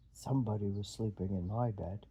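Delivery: low big bear voice; Papa Bear. Acted.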